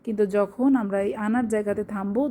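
normal talking voice

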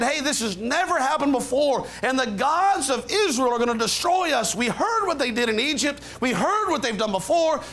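high, urgent panic building to a rallying cry; scared Philistines. Acted. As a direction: voice the frightened enemy rallying themselves, pitch climbing. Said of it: "and they said".

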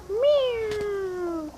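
high, mewing cat imitation; a short, high miau; cat meow. Parrot turned cat, meows.

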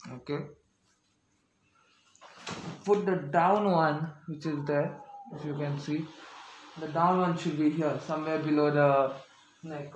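muffled behind mask; speaking with mouth covered; through a face mask. Real, not acted. Seen 0: Blue mask covers mouth and nose throughout while adjusting straps.

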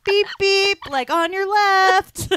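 high, slow, loud mock pilot; breezy taunt while passing on fire; hammed-up plane pilot overtaking; cheeky pilot. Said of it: "almost like".

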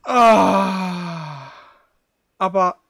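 usual speaking voice

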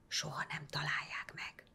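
hushed whispered promise. A narrator's reading. Boy assuring the priest in a whisper. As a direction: whisper it confidently.

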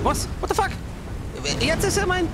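high startled cry of disbelief; shocked outburst. Real, not acted. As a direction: yelp it out, caught off guard. Sudden shock in game.

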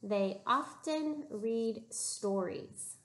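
slow, clear modeled sentence; teacher modeling the sentence slowly for the class; slow model reading. Shown as a demonstration. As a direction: read it slowly, each word clear.

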